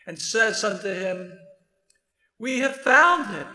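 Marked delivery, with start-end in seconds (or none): 2.4-3.5 higher, louder burst of discovery; acted excitement, pitched up and emphatic; excited announcement. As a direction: proclaim it like thrilling news to a friend. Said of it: "Can you hear the excitement in Philip's voice".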